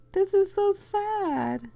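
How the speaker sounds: high, drawn-out lament; mock-sad whine. Real, not acted. Reader reacting to the unconscious man's scene, mock-sad whine. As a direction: wail it long and high, playfully pitying.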